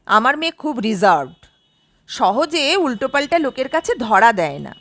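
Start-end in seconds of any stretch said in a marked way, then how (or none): none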